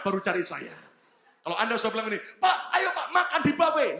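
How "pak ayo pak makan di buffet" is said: high, loud eager invite; mock invitation. Caller inviting the pastor to a buffet, high eager call. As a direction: call out eagerly to come eat.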